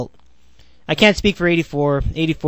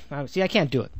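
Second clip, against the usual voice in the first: ordinary talk, nothing marked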